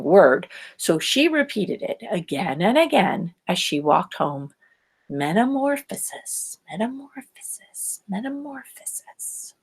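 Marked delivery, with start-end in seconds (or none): 5.1-9.5 fading from spoken to a whisper; repeating to herself. Acted. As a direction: say it over and over, dying away to a whisper. Velma repeats word walking home.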